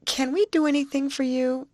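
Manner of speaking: high, hesitant false starts; halting, tentative high voice; half stammering. Acted. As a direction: falter and restart an awkward offer. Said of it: "half stammered Betty".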